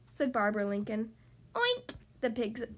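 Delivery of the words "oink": short, very high piglet oink. A narrator's reading. Piglet answers Barbara's offer, high oink. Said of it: "piglet said again".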